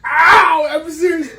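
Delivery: sharp yelp of pain, then high; pained shout. Real, not acted. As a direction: cry out from the burn. Burning mouth from hot sauce.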